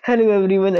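teasing put-on cheery greeting; mock podcast greeting. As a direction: mimic a sudden switch into show voice. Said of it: "कैसे flip मारा तुमने tone में".

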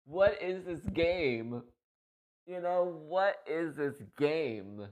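stunned disbelief. Retold or imitated. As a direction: stretch each word, amazed at the game. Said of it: "And I'm like".

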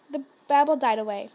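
ordinary delivery

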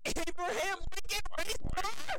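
squeaky high, slow and stretched; mock high drawn-out question. Mocking a friend's take, high and slow. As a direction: stretch it out in a silly falsetto.

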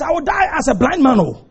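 normal talking voice

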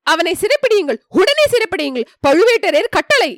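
demanding the arrest, pitched high; yelling. Acted. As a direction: yell it as a command from above. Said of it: "என்று கத்தினான்".

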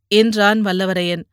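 plain reading voice

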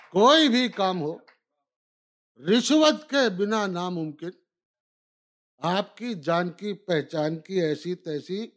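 measured, declaimed verse lines; stately nazm recitation. Acted. Recites nazm Aisi Taisi.

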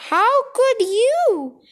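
high, slow, wailing reproach; appalled reproach. A narrator's reading. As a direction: stretch it out in shocked disgust. Boy appalled at grandma.

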